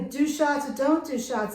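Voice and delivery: ordinary talk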